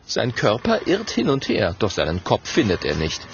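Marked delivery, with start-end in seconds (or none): none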